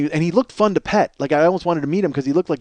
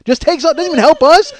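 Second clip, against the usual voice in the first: ordinary talk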